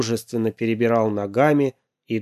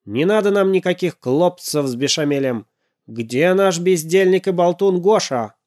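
angry; 6 semitones higher, 3 dB louder than usual. Acted. Dad angry at robot.

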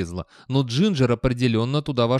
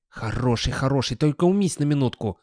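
muttered, impatient humouring; grumpy mutter; 3 semitones higher than usual. A narrator's reading. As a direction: humour an excited dog grudgingly. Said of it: "пробормотал Гаспод".

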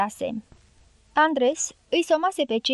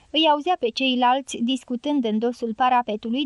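ordinary delivery

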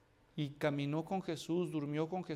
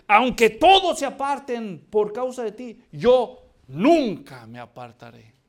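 loud, bold vow, slowing on the last words; boastful Peter; 4 semitones higher, 12 dB louder than usual. Acted. Peter boasting to Jesus that he will never leave, loud and proud. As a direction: swear it proudly for everyone to hear.

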